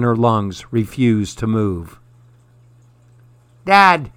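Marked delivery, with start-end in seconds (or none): 3.6-4.1 high, louder cry of "Dad"; frightened yell. A narrator's reading. Gray girl calls her dad after spotting an intruder, yelling. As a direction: call out for dad in alarm. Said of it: "yelled the gray girl".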